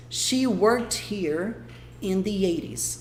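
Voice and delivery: very slow, split in two with a pause; slow broken demo. Shown as a demonstration. Teacher slowly repeating the sentence in two parts. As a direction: drag it out, pause before the last part. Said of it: "mais um exemplo aqui".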